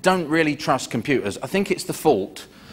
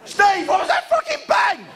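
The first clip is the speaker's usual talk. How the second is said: high-pitched panicked astronaut shout. Acted. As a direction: scream it at the crew mate.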